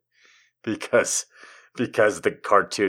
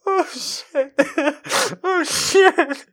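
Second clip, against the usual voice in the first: very high squeaky laughter through words; helpless laughing; much slower than usual. Real, not acted. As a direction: squeak it out while cracking up.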